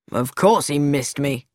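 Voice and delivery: low-pitched, irritable snap; snappish. A narrator's reading. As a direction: snap it impatiently in a deep voice. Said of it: "snapped Lewis".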